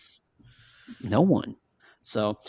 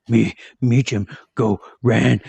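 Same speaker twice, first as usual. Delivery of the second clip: louder, grunting caveman voice; caveman talk; 6 dB louder, slower than usual. Retold or imitated. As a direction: bark out words like a caveman. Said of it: "talking like a caveman".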